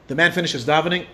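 ordinary delivery

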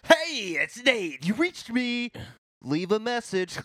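high, bright silly greeting; sing-song high answering machine; goofy voicemail voice. Pretend. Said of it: "I'm a funny guy now".

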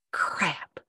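unvoiced whispered curse. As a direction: hiss it under your breath. Inner dismay at seeing him.

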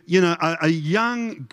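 ordinary talk, nothing marked

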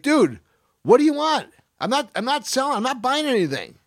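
imitating snapping at a guy at the door; retold angry yell. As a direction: bark at the stranger to leave. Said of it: "I've all but screamed at him".

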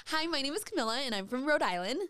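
chirpy Rhode Island pageant intro; Rebecca voice. Shown as a demonstration. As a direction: introduce yourself like a pageant contestant. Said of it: "say it in the Rebecca voice".

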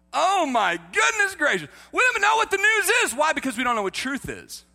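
high, loud, rushed outburst; exasperated preaching shout. Real, not acted. Preacher exasperated over the news, loud high shout.

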